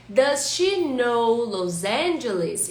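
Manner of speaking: slow and careful, each word laid out; teacher modelling a correct question, slow; slow model question. Shown as a demonstration. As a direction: model the English question slowly for students. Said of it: "a pergunta correta é".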